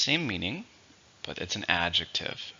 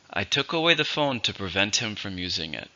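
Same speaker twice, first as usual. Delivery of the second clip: normal talking voice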